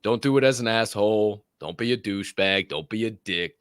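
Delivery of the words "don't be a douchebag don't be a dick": ordinary talk, nothing marked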